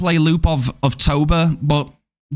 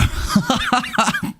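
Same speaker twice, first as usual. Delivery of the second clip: high, staccato giggle; laugh; 9 semitones higher, 3 dB quieter than usual. Real, not acted. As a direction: burst of quick high laughs. Laughing at his own joke.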